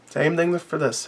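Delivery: "Same thing for this" dropped to a soft murmur to himself; quiet echo. Real, not acted. Reviewer trailing off to himself, softly repeating.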